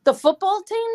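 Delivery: loud, high, amused disbelief; loud astonished query; incredulous question. Real, not acted. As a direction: pitch it way up in disbelief. Incredulous question, breaks into laughing.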